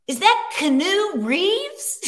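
high, slow, incredulous gasp of a question; stunned disbelief. Retold or imitated. A friend spots a star in the lobby, stunned and high. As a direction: coworker spotting Keanu Reeves, voiced in high stunned disbelief. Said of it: "Creek goes".

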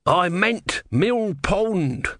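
high, very drawn-out protest; wailed correction. Acted. Tortoise god corrects himself at sea, high slow wail. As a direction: call out the correction, stretching each word. God's promise has gone wrong.

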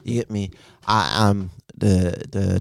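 normal talking voice

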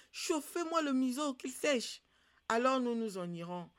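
high, loud bossy dog voice; storyteller's loud, insistent dog; demanding dog. Acted. Dog orders the others.

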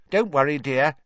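a hint of motherly trolley lady; kindly trolley witch. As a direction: reassure a child cheerily. Trolley witch reassures the children.

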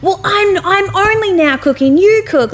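high-pitched whiny protest, imitated; hammed-up bickering comeback; mock indignant partner. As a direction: protest as the put-upon partner. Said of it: "And then he's like".

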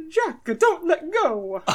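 high, drawn-out melodramatic cartoon voice; Mario. Auditioning on request with a dramatic movie line, Mario voice. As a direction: plead slowly in a high plumber voice. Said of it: "give us a dramatic movie line".

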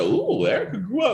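high-pitched "ooh" of mock admiration; hammed-up high admiring aside; retold admiring reaction. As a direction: go up high, impressed and playful. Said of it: "I said".

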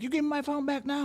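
mock screech; shrill demand. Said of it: "She's like".

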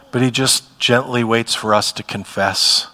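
usual speaking voice

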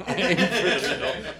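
laughing and loud through the line. Real, not acted. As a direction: crack up while repeating it. Repeating the quote, laughing.

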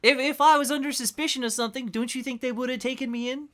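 high-pitched, flustered protest; indignant suspect. Acted. Suspect protesting to the investigators questioning him, high-pitched and flustered. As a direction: stammer through it in a high voice.

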